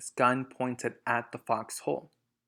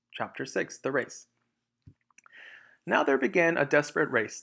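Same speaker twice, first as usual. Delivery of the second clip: ordinary delivery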